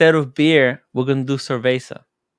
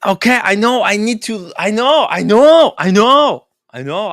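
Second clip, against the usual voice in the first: high, loud defensive outburst; exasperated protest; 8 semitones higher, 8 dB louder than usual. Real, not acted. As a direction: cut off the nagging, pitched up and loud. Defending himself against her nagging.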